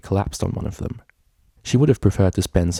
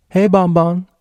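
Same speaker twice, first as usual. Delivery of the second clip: much higher, louder cheerful call; cheerful greeting; 11 semitones higher, 7 dB louder, slower than usual. A narrator's reading. Vinyl calling up cheerfully to sleepy Bonbon. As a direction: call up to the window brightly. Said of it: "greeted her cheerfully".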